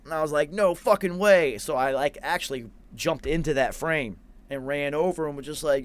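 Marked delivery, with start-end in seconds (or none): 0.5-1.6 loud shocked exclamation; stunned disbelief. Retold or imitated. To himself on seeing his little sister, loud disbelief. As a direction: shout it out, floored by what you see.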